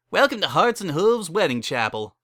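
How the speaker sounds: high, loud, eager wedding-chapel host; cheery chapel keeper. Acted. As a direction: sell them a wedding with pushy cheer. Barry greets the stallions.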